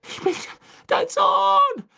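very high, loud, desperate scream. A narrator's reading. Boy fighting the Dementors begs his father, screaming. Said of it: "schrie er zu Crouch hoch".

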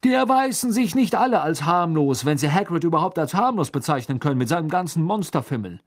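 high, loud indignant tirade; outraged, high-pitched argument; agitated. A narrator's reading. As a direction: rant at him in a worked-up voice. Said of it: "sagte Fudge aufgebracht".

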